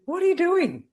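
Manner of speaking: high, loud, exasperated demand; mock exasperated yell; blunt shout. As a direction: bark it like a boss losing patience. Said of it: "too to the point".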